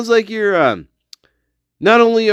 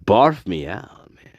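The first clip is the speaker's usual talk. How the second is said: put-on valley girl whisper; 11 semitones lower, 3 dB quieter than usual. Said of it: "She's a valley girl".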